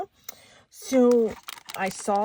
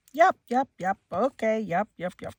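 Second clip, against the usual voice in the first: mock brush-off, rattled off and fading; dismissive yessing; 2 semitones higher, 3 dB louder than usual. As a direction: imitate someone yessing you without listening. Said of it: "I always get yepped".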